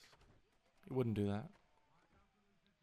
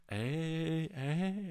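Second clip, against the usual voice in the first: higher mock hype call; hyping friends; 7 semitones higher, 5 dB louder than usual. Friends catch him talking to the girl, higher hype call. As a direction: call it out like teasing buddies. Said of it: "they were like".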